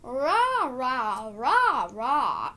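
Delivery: loud half-asleep "rah" grunts, each one rough and drawn out; imitated groggy noises. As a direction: mimic the drugged-up sounds you made, loud and strange. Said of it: "I was making these weird noises".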